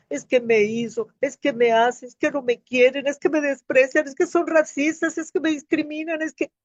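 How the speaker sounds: put-on rising, childish complaining whine; whiny child. Woman mimicking her own childish whining about others. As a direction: whine like a sulking kid blaming everyone. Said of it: "como un niño".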